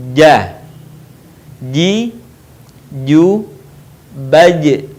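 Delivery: isolated "ja, ji, ju, baj", middle of tongue on palate. Shown as a demonstration. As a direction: separate each of "ja, ji, ju, baj" with pauses. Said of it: "menyentuhkan tengah lidah kita". ("ja, ji, ju, baj" satu-satu, tengah lidah ke langit-langit)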